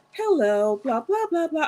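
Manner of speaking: lightly mimicked prim, overly proper greeting; exaggerated proper speech. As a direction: lay on the proper English, just a touch. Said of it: "purposely speaking as proper as possible".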